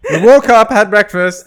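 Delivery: loud retold complaint; exasperated listing. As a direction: pile up the list in mock exasperation. Mom's retold exasperated list.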